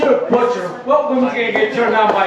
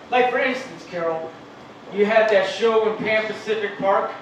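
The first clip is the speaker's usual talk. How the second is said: normal talking voice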